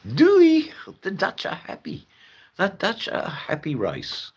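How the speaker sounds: high, squeaky happy Dutch voice; cheery mock-Dutch character. Streamer praising the happy Dutch, high-pitched mock-Dutch cheer. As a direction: play a gleefully contented Dutch caricature.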